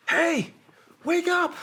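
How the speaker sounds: high, loud call to rouse him; wake-up call. Retold or imitated. Teammate calls to the downed soldier, high loud shout. Friend kicking him awake.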